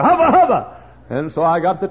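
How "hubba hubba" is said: loud cheer; hollering. Retold or imitated. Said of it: "touch the top and holler".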